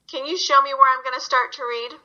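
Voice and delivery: ordinary delivery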